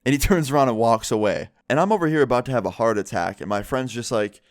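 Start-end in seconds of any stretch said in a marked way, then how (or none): none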